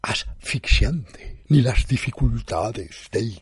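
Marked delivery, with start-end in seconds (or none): none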